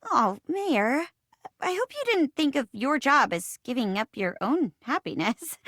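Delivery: ordinary talk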